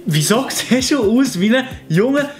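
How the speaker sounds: ordinary talk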